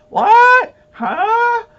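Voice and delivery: high, loud mock yell of a deaf man who can't hear; deaf man shouting. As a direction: bellow "what?" like you can't hear a thing. Deaf witness mishearing in court.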